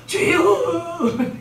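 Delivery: drawn-out loud cry to the Lord. Retold or imitated. Preacher imitating the boy crying out in worship, loud and drawn out. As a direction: wail it like a praying teen.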